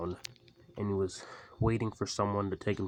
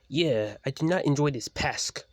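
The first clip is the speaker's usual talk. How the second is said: a loud, higher snap at the pest; snapping; 6 semitones higher, 7 dB louder than usual. Acted. Swedish engine complaining about his cousin's friends, loud snap. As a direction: bite the words off, irritated. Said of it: "snapped the Swedish engine".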